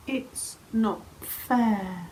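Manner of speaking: slow, soft, sorry little protest; a bit sad. Acted. Dragon complains to his mum, a bit sad. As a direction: say it softly and sadly, words drawn apart. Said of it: "He was a bit sad".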